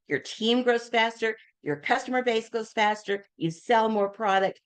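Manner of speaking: usual speaking voice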